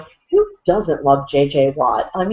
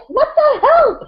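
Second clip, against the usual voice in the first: exasperated yell; 3 dB louder than usual. Acted. Mocking Peyton's outburst.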